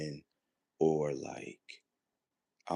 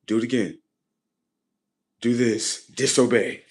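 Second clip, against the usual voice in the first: loud, raised-pitch orders; acted commands; 5 semitones higher, 8 dB louder than usual. Playing the upset system giving orders, loud commanding. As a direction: fire off each order loudly, pitched up.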